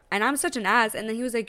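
normal talking voice

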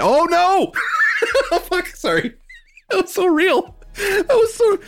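high startled yelp and laugh. Real, not acted. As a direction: jump out of your skin. Startled by knife trick.

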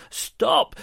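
high, loud cry to halt; shout. A narrator's reading. Mum shouting at the duck with her ring, alarmed shout. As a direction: shout it high at a fleeing thief. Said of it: "she shouted".

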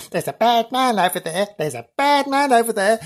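high-pitched mock little girl; young girl's voice. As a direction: warn like a ghostly child. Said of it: "There's a young girl's voice saying".

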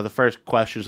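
ordinary talk

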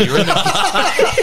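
high, slow, breaking into laughter; can't finish the line for laughing. Real, not acted. Telling mates the fake croc story, cracking up.

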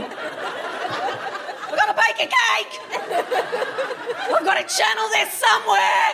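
very high, slow, airy mock squeal of a mother-in-law; frantic mother-in-law. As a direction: squeak it out like a frazzled mother-in-law baking at two AM. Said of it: "Just a very chill thing to do".

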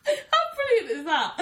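ordinary talk

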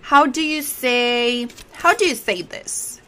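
drawn-out example question; slow model question. Shown as a demonstration. Teacher shows how to ask about a written word, slowly. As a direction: stretch it out as a learner's example.

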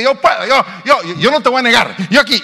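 high, loud, eager boasting; boastful eager volunteer. Retold or imitated. The impulsive brother volunteering, high and loud and boastful. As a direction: puff up and jump at the chance. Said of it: "el otro es un aguerrido".

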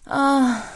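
sharp high cry of pain. A narrator's reading. Dying wife crying out as her tree is cut. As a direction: let out a sudden pained cry. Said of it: "With another cry of pain".

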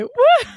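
high, loud laughing "woo"; mock celebratory cheer. Crowd cheering Dirt Boy's award, celebratory whoop. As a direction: whoop for the winner through laughter.

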